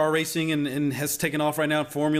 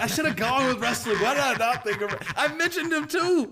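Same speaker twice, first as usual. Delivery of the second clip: laughing; 10 semitones higher than usual. Real, not acted. Laughing at his own mistake.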